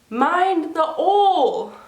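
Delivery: put-on high, drawn-out French-accented warning. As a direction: call it out with a French accent, H dropped. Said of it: "keep in mind she's very French".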